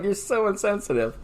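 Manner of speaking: mock scolding wife. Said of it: "she's just like".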